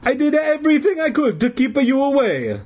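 put-on high, pleading Italian accent; aggrieved old Italian janitor. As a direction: protest in a hammed-up Italian accent. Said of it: "in his hoarse Italian voice".

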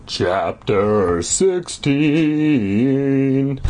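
drawn-out theatrical title; hammed-up chapter announcement. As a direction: stretch both words, rising on the number.

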